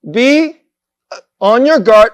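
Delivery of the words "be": usual speaking voice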